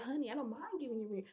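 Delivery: hushed, hurried sweetness through a smile; forced smile. Retold or imitated. Reassuring girlfriend while hiding trouble from roommates, with a forced smile. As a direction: placate softly, keep it looking friendly. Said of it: "trying to keep a smile on my face".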